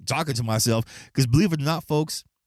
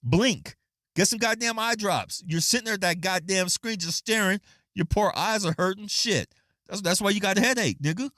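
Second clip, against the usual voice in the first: mock scolding; 7 semitones higher than usual. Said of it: "I typed on there".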